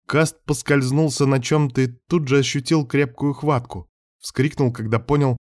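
ordinary delivery, nothing marked

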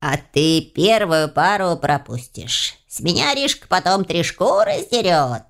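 slow, creaking warning; creaky house spirit. A narrator's reading. House spirit warning the late student, creaky scolding. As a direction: creak out the warning with drawn-out words. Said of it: "проскрипел желтоглазый будильник".

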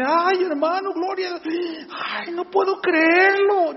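muy agudo, lento, incrédulo de gozo; la gente no lo puede creer, agudo; asombro gozoso. Retold or imitated. La gente ante el milagro. (la gente alaba el milagro, muy aguda y asombrada)

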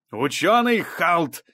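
high, slow commanding call; thundering judge's reprimand; thunderous voice. A narrator's reading. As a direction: boom the title to stop them. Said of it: "подобен грому".

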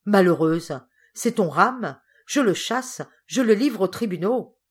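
loud, high furious denunciation; angry outcry. A narrator's reading. Uncle pushes niece away and denounces Ram, angry outcry. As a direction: cry out in wrath, pitch climbing. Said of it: "il s'écria".

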